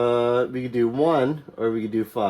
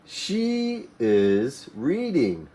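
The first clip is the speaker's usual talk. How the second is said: slow model reading; slower than usual. Shown as a demonstration. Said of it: "Who's reading?".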